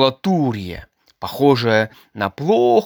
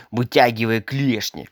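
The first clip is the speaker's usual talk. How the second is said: usual speaking voice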